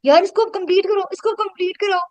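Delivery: high-pitched demanding plea; imitating demanding participants. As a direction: mimic participants who insist it be finished. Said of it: "और वो कह रही हैं".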